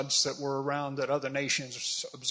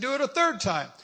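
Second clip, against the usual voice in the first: high, insistent order; commanding; 9 semitones higher than usual. Acted. Elijah ordering yet more water poured, commanding. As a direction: pitch the order well up.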